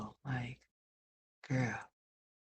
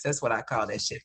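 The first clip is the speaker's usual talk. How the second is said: ordinary delivery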